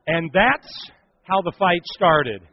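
slow, loud, drawn-out punchline; punchline refrain. Acted. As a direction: land the punchline slowly and loudly.

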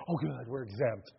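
a hint of a sheepish disciple murmuring his relief; relieved Peter. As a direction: Peter quietly relieved he got away with it. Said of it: "Can you see Peter going".

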